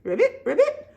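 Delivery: high, quick ribbits; frog's ribbit. Acted. Reader answers what the toad says, high ribbits. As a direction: ribbit like a little frog. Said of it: "What did the toad say?".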